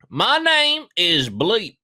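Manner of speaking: high, slow mock American accent; exaggerated high Kentucky drawl. Shown as a demonstration. Reading the witness statement aloud in a put-on American accent. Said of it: "read it in an American accent".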